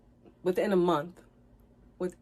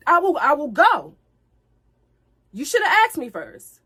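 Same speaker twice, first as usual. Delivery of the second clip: louder and higher, building into real anger; heated indignation; 3 semitones higher, 7 dB louder than usual. Real, not acted. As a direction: let the outrage build as you lay down your rules. Recalling being triggered, rising anger.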